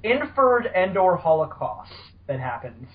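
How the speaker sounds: ordinary talk, nothing marked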